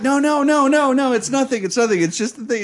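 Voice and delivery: high, rapid-fire string of denials; urgent reassurance. Retold or imitated. Boyfriend calming girlfriend about the hook, urgent reassurance. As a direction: imitate frantically talking someone down.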